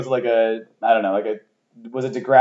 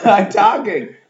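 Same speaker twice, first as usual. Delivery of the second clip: normal talking voice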